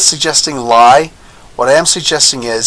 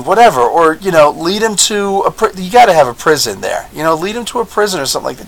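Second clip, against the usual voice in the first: usual speaking voice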